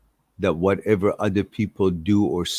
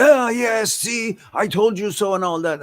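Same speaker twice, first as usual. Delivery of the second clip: gloating donkey; 13 semitones higher than usual. Acted. Donkey gloats at the tiger after winning, smug and higher. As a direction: crow over the tiger like a smug winner.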